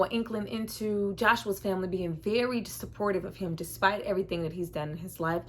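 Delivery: ordinary talk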